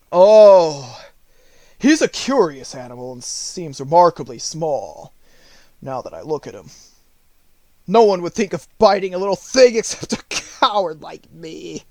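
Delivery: a cowardly lion, half laughing at himself; bashful big-cat voice. Acted. Lion speaking to Dorothy.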